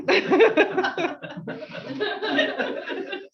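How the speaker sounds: a real burst of laughter, high; embarrassed laugh. Real, not acted. Chair laughs at going off her own script. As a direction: laugh at yourself for slipping up.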